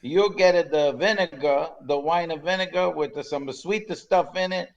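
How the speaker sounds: mock Italian waiter scorning cheap vinegar; Italian accent. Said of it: "your Italian accent is excellent".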